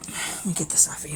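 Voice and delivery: soft unvoiced whisper. Real, not acted. Owner whispering to her dog while taking something off him. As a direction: breathe it, no voice at all.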